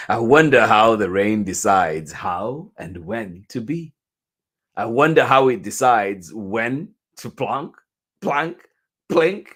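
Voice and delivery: loud, musical read with each sound word set apart; wondering story read. A narrator's reading. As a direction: wonder aloud, then drop the plinks like raindrops. Reads picture book aloud.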